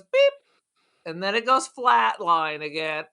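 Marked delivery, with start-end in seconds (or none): 0.0-0.3 short, high electronic-style beep; imitated heart monitor beep. Host comparing movie to a dying patient, imitated monitor beep. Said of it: "the heart rate just kinda goes".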